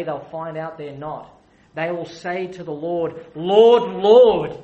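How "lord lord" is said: loud plea. Acted. The lost pleading on final day.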